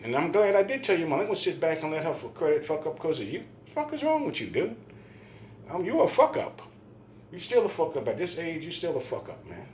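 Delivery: retold angry berating. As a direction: lay into him, reliving the argument. Re-enacts berating the man.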